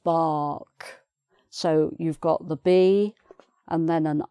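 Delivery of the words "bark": "bark" as "baahk", long open vowel and silent R; British "bark" with the R left out; no R sound. Shown as a demonstration. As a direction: draw out the "ah" in "bark", no R. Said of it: "we don't even try to do an R".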